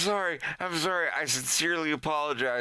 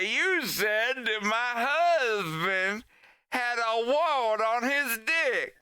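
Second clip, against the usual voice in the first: higher and slow, drawn-out accusation; whiny accusation; 9 semitones higher, slower than usual. Retold or imitated. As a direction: stretch the outrage out. Accuser voice again.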